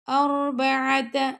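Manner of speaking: أَرْبَعَةَ with ع from the throat; clear ain. Shown as a demonstration. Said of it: "عین کا مخرج".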